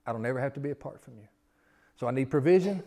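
ordinary delivery

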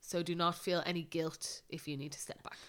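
whispered reassurance; soft, gentle whisper. Real, not acted.

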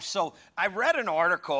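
ordinary delivery

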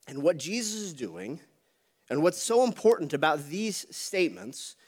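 ordinary talk

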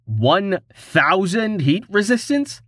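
high, slow, incredulous question; can't believe what he's reading; stunned disbelief. Acted. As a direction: pitch jumps up in slow amazement. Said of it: "not believing his eyes".

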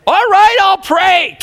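grudging shout. Retold or imitated. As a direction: shout it in defeat. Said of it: "And then I exploded".

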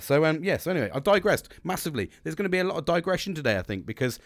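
ordinary talk

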